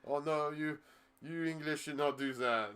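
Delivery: high, prim dismissive voice; mock European club. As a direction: primly wave the idea away. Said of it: "going like".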